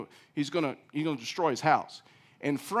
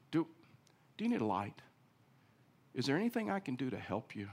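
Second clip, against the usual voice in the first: soft, kind offer of help; gentle offer; 2 semitones lower, 4 dB quieter than usual. Pretend. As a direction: speak softly to a neighbour in trouble. Kind offer to neighbour in dark.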